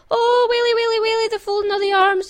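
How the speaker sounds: very high, loud wail; hammed-up high cry of dread; panicked crying out. A narrator's reading. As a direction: wail shrilly like a panicking mob. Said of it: "the Feagles cried".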